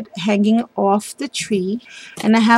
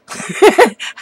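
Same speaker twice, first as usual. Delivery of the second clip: brief, higher-pitched giggle; real little laugh, louder than the talk; short giggle; 9 semitones higher, 5 dB louder than usual. Real, not acted. Crafter amused about her daughter's curly hair, short giggle.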